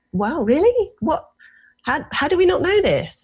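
astonished. Retold or imitated. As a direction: sound genuinely floored. Astonished reaction retold.

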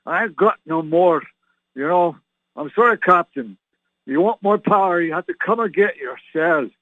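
hammed-up Scottish accent as Scotty pleading with Kirk; Scottish ship's engineer pleading for power, light Scottish lilt; Scotty impression. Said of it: "I used to say to Captain Kirk".

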